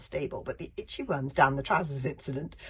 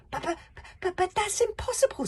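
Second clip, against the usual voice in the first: shocked, much higher stammer; 8 dB louder than usual. Acted. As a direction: stammer in disbelief. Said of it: "stammered the hairy, scary librarian".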